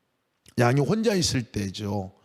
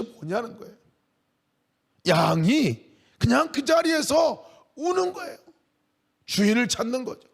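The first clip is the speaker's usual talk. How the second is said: preaching at full force; 8 semitones higher than usual. Acted. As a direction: build the plea with rising fervour. (열정적으로 높여 외치는 설교)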